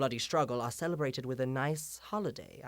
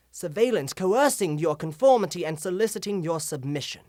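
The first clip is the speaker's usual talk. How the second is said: louder, higher, driving accusation; forceful denunciation; 5 semitones higher, 10 dB louder than usual. Acted. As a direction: build to a ringing indictment. Indictment of the regime builds.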